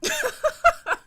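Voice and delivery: loud shrill cackle; high cackling laugh. Real, not acted. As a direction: burst into a cackle.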